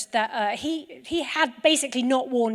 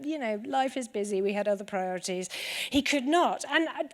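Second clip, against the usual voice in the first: ordinary delivery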